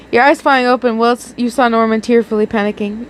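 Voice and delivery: plain reading voice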